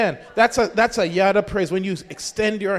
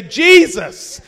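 high, ringing shout of praise; loud shout; 9 semitones higher, 10 dB louder than usual. Shown as a demonstration. Preacher demonstrates Shabach praise to the church, shouting. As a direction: shout it out with full force. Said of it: "It means with a loud shout".

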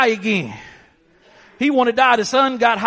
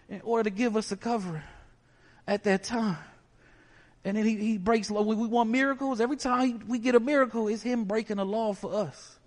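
hushed preaching; 6 dB quieter than usual. Acted. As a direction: drop to a near-hush to draw them in. Quiet, soft preaching stretch.

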